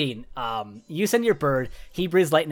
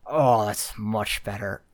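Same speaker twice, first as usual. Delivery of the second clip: orc doctor, lightly voiced; 5 semitones lower than usual.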